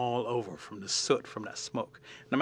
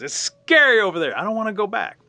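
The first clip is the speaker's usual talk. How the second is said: high, loud protest of a little creature; scared refusal; 8 semitones higher, 9 dB louder than usual. Acted. Little raccoon refuses to go back, acted scared protest. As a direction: protest like a frightened little animal.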